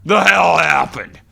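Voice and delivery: loud, high, slow anguished wail of a phrase; crying outburst. Retold or imitated. Imitating Bret cracking on camera, loud slow wail. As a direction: howl it like a man cracking. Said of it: "He just".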